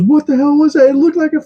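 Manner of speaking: very high, incredulous imitation; giddy pilot. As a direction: play a pilot squeaking in amazement. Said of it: "he's, like, giggling".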